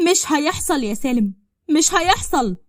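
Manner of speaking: loud, high insistence, rising on the repeat; defiant refusal. Acted. Ferdous refuses forced marriage to Salem, loudly defiant.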